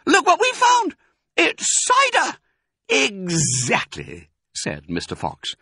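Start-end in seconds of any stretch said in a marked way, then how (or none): 0.1-2.4 very high, loud little-fox squeal; excited cry. A narrator's reading. Smallest fox finds cider, thrilled cry. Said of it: "he cried out". 2.9-4.3 slow, higher, relished word; drawn-out satisfaction. A narrator's reading. Mister Fox confirms the find, savouring it.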